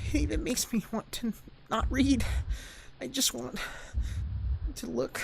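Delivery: put-on a shy girl whose heart is racing; breathless Yuri. Voices Yuri losing control.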